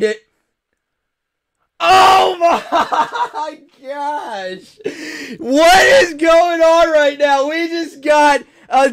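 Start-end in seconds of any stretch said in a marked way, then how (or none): 1.8-7.5 shocked laughter. Real, not acted. Double error pack pulled.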